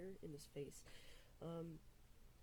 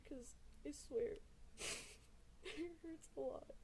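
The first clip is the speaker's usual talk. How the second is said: crying; 8 semitones higher, 3 dB quieter than usual. Real, not acted. Crying while telling of trauma.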